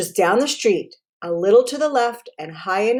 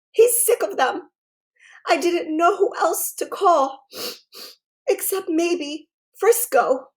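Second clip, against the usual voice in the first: put-on a girl on the verge of tears, pitched up; tearful girl; 7 semitones higher than usual. A narrator's reading. Caitlin begs the science detective for help, high and teary.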